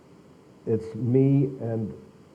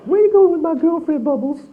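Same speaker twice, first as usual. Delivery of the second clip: much higher, louder falsetto echo of Michael; repeating [name]'s line; 8 dB louder than usual. Retold or imitated. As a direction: quote him back in that high, playful voice, amazed. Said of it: "looked at each other going".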